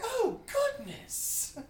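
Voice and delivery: high, drawn-out dismay; hammed-up old-lady horror, very slowly; imitated shocked old lady. Acts out the old woman's shock.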